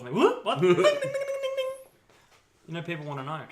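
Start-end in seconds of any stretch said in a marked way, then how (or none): 0.1-0.6 high, loud startled yelp. Pretend. Imitating a startled reaction. 0.6-1.8 very high, loud ding noises; imitated bell ding.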